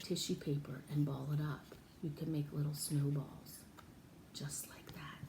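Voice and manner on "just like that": soft whisper. Real, not acted. Teacher showing the tissue snowball, whispering. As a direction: breathe it out as a little secret.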